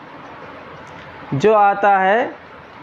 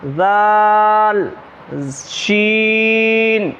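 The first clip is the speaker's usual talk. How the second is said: very slow, stretched letter names; read aloud, drawn out; much slower than usual. Shown as a demonstration. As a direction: pull each letter name out long and aloud. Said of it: "बआवाज़ बुलंद पढ़ेंगे और थोड़ा खींच खींच कर पढ़ेंगे".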